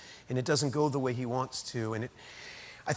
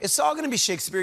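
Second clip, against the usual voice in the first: higher, louder, quick echo of the host; mock excited echo; 8 semitones higher, 4 dB louder, faster than usual. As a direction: throw the line back with playful excitement. Guest echoes host, mock excitement.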